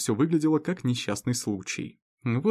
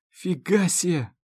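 exclaiming; 6 semitones higher, slower than usual. Acted. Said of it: "воскликнул я".

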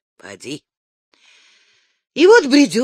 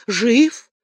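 second «Жив!» much higher and louder; joyful; 5 semitones higher, 8 dB louder than usual. A narrator's reading. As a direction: burst out in joy. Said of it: "обрадовался Першин". (радостно, громко выкрикнуть)

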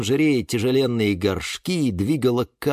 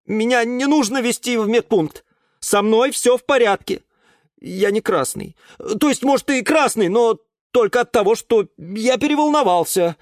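a mock-stern, higher young man; 11 semitones higher, 3 dB louder than usual. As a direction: address her formally and sternly. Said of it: "строго произнёс Юра". (строго, с лёгкой важностью)

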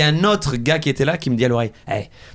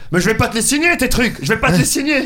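lightly voiced Fernandel impression, higher; higher put-on old French comic voice; 8 semitones higher than usual. Shown as a demonstration. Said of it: "t'as la voix de Fernandel".

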